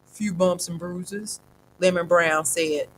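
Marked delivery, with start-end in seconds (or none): 0.1-1.4 put-on slow, softer old voice; old man after a brawl, brushing it off; weary old man. A narrator's reading. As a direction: shrug off the pain slowly. Old man tired after fight.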